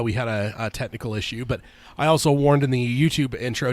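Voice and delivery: ordinary talk, nothing marked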